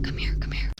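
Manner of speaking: imitated furtive whisper; secretive whisper. Boy repeats secret summons, secretive whisper. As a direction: beckon under your breath.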